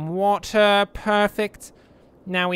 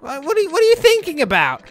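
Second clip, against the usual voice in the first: much higher, louder mock outrage; retold yell; 12 semitones higher, 7 dB louder, faster than usual. Ranting at someone who built a bad intersection, mock yelling. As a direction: shout the question in disbelief. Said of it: "I didn't yell that much".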